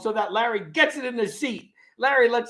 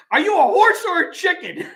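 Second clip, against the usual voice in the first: laughing Curly line; 8 semitones higher, 6 dB louder than usual. Acted.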